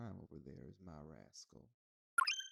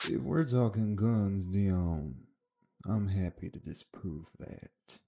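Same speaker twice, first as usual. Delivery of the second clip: booming, slow menacing call from across the room; loud threat; 19 dB louder, slower than usual. Acted. Joseph shouting a threat at Dion on arrival, slow and loud.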